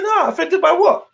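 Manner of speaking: high, puzzled offended by what; mock-innocent high question; incredulous reply. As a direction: play dumb, pitch jumping up. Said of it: "I said".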